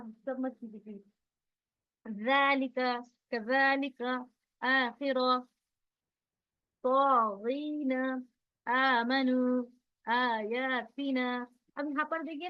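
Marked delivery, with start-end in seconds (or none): 2.2-11.5 slow recitation, "aa" held two beats in जालिका and आमनु; stretched long vowels. Shown as a demonstration. Teacher reciting examples for students, stretched long vowels. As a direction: draw out every long vowel in तागीना and आयातिना. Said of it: "दो हरकत के बराबर खींचना है".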